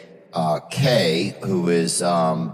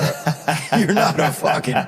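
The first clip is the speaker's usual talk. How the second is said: laughing; 7 semitones higher than usual. Real, not acted. Cracking himself up mid-joke.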